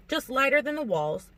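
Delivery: plain reading voice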